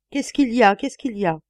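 high, loud frightened question; panicked cry. Acted. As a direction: shout it half-awake from a nightmare. (cri paniqué)

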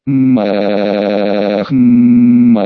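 acted drawn-out moaning; loud moan. A narrator's reading. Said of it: "громко простонала".

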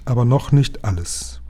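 ordinary delivery

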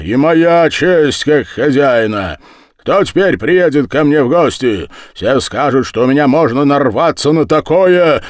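loud, high indignant protest; resounding, heated complaint; booming. A narrator's reading. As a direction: boom out like an offended host. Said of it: "гудел Дуботолк".

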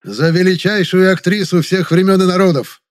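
high, loud proclamation of praise; grand toast. A narrator's reading. Guest hails the actress before her rivals, loud and grand. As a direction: proclaim it to the whole room.

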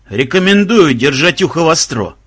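ordinary delivery, nothing marked

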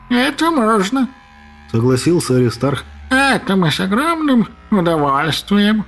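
a purring tomcat voice; cat voice. Acted. Cat agrees gleefully.

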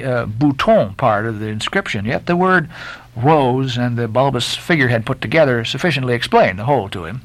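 usual speaking voice

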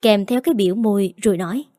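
ordinary delivery

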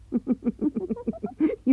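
a short high laugh that falls away; amused scoffing laugh. Acted. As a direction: laugh it off at her sister. Sister laughs at teasing.

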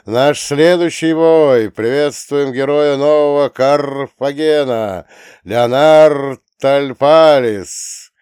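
loud, high, drawn-out hype voice; arena announcer. Acted. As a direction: pump up the crowd like a ring announcer. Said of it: "голос ринг-анонсера".

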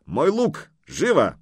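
brisk, high-pitched order; shouted command. A narrator's reading. Horseman ordering his companion to fetch a weapon, calling out. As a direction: call for it urgently. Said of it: "крикнул он одному".

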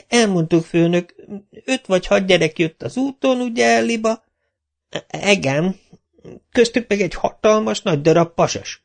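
high, whiny protesting voice; lackey Kapa whining at his boss. A narrator's reading. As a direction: whine it like a put-upon drunk. Kapa's long excuse.

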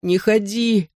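put-on high, squeezed-out childish plea; sobbing child. A narrator's reading. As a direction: force out a tearful plea. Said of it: "выдавил из себя рыдающий тигр".

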